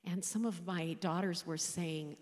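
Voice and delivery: ordinary delivery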